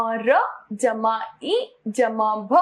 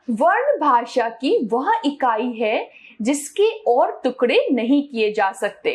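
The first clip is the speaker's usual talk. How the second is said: usual speaking voice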